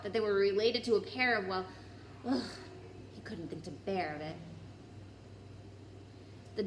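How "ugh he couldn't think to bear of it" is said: usual speaking voice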